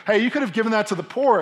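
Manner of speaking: high, loud call meant to be overheard; really loudly. Acted. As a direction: say it pointedly loud so Jesus overhears. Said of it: "being like really loudly so that".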